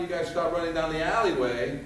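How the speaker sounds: ordinary talk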